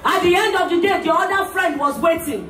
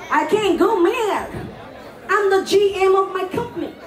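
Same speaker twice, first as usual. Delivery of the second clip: classy English-speaking lady; 4 semitones higher than usual. Acted. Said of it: "The two classy women spoke English".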